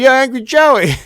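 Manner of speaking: high, loud chirpy hello; loud sing-song welcome; mock cheery greeting. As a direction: greet him with fake glee. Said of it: "So I'm like".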